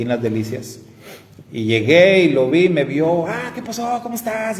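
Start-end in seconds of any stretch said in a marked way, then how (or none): 3.3-4.6 high, bright surprised hello; cheerful greeting. Retold or imitated. Old school friend spotting him at the butcher's, cheery high hello.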